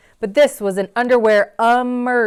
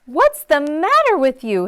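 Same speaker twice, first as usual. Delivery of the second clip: concerned mom; 7 semitones higher, 7 dB louder than usual. Acted. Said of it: "his mom asked".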